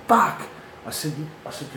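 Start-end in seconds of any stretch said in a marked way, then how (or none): 0.1-0.4 sudden high loud swear of shock; shocked curse. Retold or imitated. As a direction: blurt it out, stunned. Shocked at ambulance outside.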